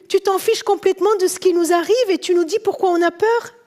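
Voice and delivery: worked-up, high-pitched protest to the master; indignant reproach. Acted. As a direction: complain urgently, as if nobody cares. Acts disciples reproaching sleeping master.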